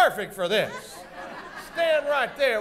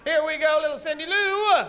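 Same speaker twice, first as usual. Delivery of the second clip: raised, higher-pitched stage call; announcing the cue; 6 semitones higher than usual. Pretend. Host cueing Cindy Lou's entrance, raised announcer call. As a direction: call the character on, drawing out the name.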